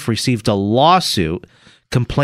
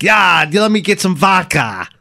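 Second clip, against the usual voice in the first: hammed-up Russian accent, pitched up; 4 semitones higher than usual. Shown as a demonstration. Host showing co-host how it's done, mock Russian accent. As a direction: do a cartoon Russian ordering a drink. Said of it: "let me hear your best Russian accent".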